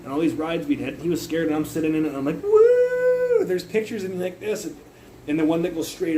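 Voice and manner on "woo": loud, very high, drawn-out whoop. Retold or imitated. Sitting in a ride beside scared friend, gleeful high whoop. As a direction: let out a high thrill-ride "woo".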